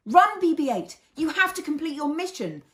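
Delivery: loud, high urgent shout; yelled. A narrator's reading. Fez yelling at BB-8 to run, loud and urgent. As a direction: yell at him to flee. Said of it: "Fez yelled".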